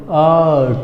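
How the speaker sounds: आर्ट with long aa and no r; teacher modelling आर्ट; silent r. Shown as a demonstration. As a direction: say आर्ट as "aat", dropping the r. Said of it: "पढ़ेंगे कैसे?".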